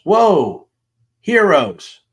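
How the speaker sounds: high, loud burst of awe; wide-eyed, loud and high; amazed exclamation. Acted. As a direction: gasp it out like you are dazzled. Reading an awed text message.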